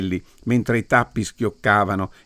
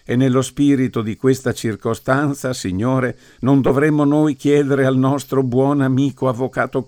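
mock solemn prayer; 3 semitones higher, 3 dB louder than usual. Priest blessing the wine for the men, mock solemn prayer. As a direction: intone it like a graveside blessing.

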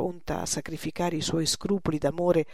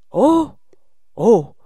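two booming ohs, first pitched high; loud exclamation; 4 semitones higher, 14 dB louder than usual. Acted. Porbus interrupts the old master's travel plans, exclaiming.

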